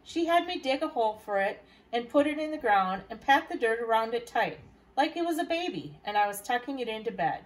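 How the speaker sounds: plain reading voice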